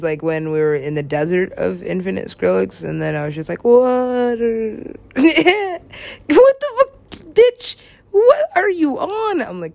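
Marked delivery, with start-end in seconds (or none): 3.6-9.4 very high, loud incredulous shriek; hammed-up shocked yell; mock outburst. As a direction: screech it out in disbelief. Acted outburst at [name].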